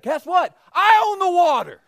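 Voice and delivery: high, loud and pointed; booming God voice. Acted. God rebuking fleeing Jonah, loud and pointed. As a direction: tell him who's boss, loudly.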